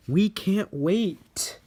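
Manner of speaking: high, slow, drawn-out excited cry; eager engines. Acted. Engines to Sir Topham Hatt, slow high excitement. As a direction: stretch it out with eager glee.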